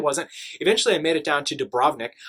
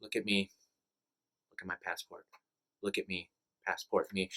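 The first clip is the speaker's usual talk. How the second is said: much softer, low, murmured guard checking papers; hushed guard voice; 7 semitones lower, 12 dB quieter than usual. Retold or imitated. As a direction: quietly voice the guard staring at him. Border guards checking passport at night.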